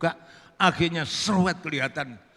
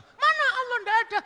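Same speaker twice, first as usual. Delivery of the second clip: squeaky-high falsetto questioning; woman's voice; 3 dB louder, faster than usual. Acted. Woman asking the visitor's name, high falsetto. Woman challenges frightened Burhan.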